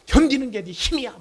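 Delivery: loud, high, insistent dad; retold scolding. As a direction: re-enact driving the point into your son. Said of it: "막 다그치면서 화를 내는데". (높고 크게 아들을 다그치는 흉내)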